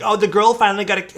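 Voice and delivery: high, loud, rushed imitation of a jeering player; mocking gamer taunt. Mimics taunting players after a kill.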